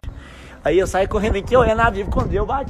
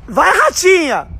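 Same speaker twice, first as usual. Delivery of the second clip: slow, high cheering shout; 9 semitones higher, slower than usual. Real, not acted. Dad yelling at the kite to climb, a slow high shout. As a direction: yell it up at the kite.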